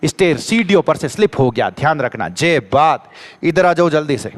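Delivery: ordinary delivery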